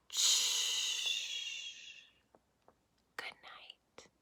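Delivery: soft whispered hush, nearly silent; bedtime whisper. Acted. Ending the bedtime book for children, soft whisper. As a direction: hush them off to sleep.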